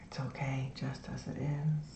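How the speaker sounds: soft, low, soothing meditation voice; guided meditation. Shown as a demonstration. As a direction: ease them into the body with long pauses. Leads a lying-down meditation.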